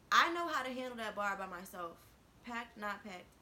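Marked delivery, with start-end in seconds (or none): 0.1-2.0 loud, emphatic insistence; loud indignant. Real, not acted. Telling the listeners about the boss, loud and indignant. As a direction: hammer it home that she can cope.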